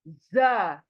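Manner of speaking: ordinary talk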